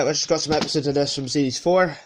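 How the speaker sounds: ordinary talk, nothing marked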